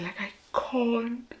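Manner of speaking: slow whisper, choked up; trembling whisper. Real, not acted. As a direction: whisper it as if you're about to cry. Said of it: "I'm actually, like, shaking".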